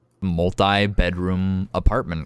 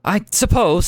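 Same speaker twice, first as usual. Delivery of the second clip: high, louder grudging reply; higher and louder, conceding; resigned brother; 13 semitones higher, 6 dB louder than usual. Acted. Brother grudgingly answering his sister, high. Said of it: "he says".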